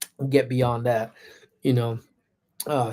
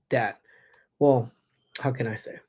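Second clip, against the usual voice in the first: usual speaking voice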